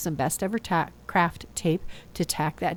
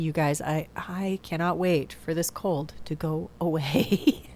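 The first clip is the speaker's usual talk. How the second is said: ordinary talk, nothing marked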